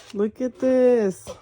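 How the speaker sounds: excited. Real, not acted. Showing off the unboxed item.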